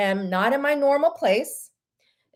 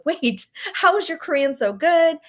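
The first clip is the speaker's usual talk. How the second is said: higher-pitched, laughing disbelief; retold surprise with a laugh after "wait"; amazed question; 7 semitones higher than usual. Customer asks the store clerk about his fluent Korean, amazed.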